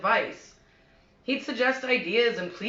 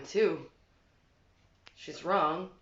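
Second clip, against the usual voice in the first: ordinary delivery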